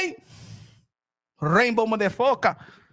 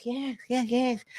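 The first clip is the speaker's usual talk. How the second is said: soft mock yes-men; 2 semitones lower, 6 dB quieter, slower than usual. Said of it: "everybody around them is".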